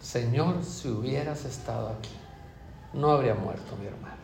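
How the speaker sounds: very soft, broken plea at his feet; barely able to speak through grief; grieving plea. Acted. María at Jesus' feet, softly grieving plea.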